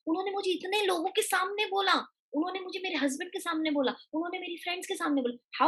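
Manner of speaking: high, quick aggrieved whine; hurt complaining. Retold or imitated. Daughter-in-law venting about the insult, high aggrieved whine. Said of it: "How she said".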